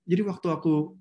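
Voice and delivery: ordinary talk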